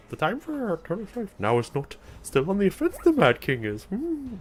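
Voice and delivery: hammed-up high impression ending in a squeaky hum; Yoda. Streamer retrying game line as Yoda, badly faked high voice. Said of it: "that's not a Yoda voice".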